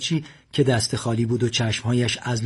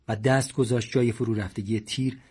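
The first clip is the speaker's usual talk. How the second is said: ordinary delivery, nothing marked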